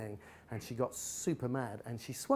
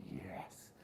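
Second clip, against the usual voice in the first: put-on breathy whispered child's yes; 6 semitones lower, 16 dB quieter than usual. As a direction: whisper it like a guilty little girl. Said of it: "She went".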